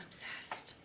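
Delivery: hushed, voiceless whisper; awed whisper. Real, not acted. Plant lover adoring her banana tree, awed whisper. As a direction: whisper it as if overwhelmed.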